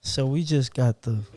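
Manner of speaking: low, smooth, seductive voice; sexy voice. Real, not acted. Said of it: "the sexy voice again".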